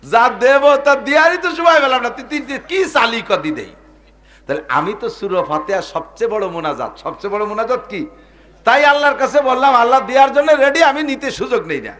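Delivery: high, loud, impassioned sermon voice; fiery preaching. Acted. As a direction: thunder it out from the pulpit. Preaching at full force. (জোরালো ওয়াজের সুর)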